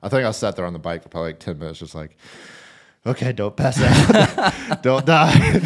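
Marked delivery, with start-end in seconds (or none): 3.0-5.6 slow, louder, higher, breaking into laughs; laughing self-talk. Retold or imitated. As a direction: coach yourself through exhaustion, laughing. Retelling self-talk while laughing.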